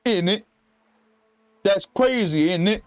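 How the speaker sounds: a half-hearted British accent; cockney-ish mock British. Shown as a demonstration. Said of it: "I'm so bad with accents".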